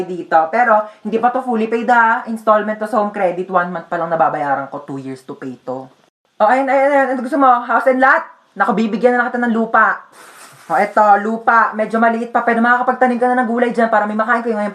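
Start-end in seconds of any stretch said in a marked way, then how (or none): none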